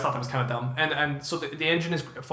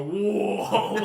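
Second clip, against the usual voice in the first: high laughing whoa; amazed; 6 semitones higher than usual. Retold or imitated. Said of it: "you see me go".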